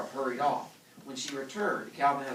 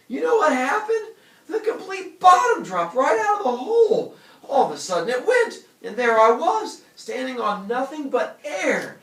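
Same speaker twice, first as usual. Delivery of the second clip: high, loud frightened babbling; 10 semitones higher, 9 dB louder than usual. Acted. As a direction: blurt it out still terrified. Calvin after nearly falling.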